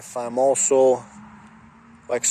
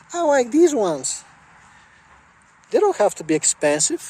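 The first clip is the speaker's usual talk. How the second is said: high, sweet, girly put-on voice; Rhea Ripley as a nice aunt; 10 semitones higher than usual. Wrestler voiced as a sweet thrifty aunt, high and girly. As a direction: play her as the sweet aunt who saves money, voiced up high. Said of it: "She made, like, like, super, like".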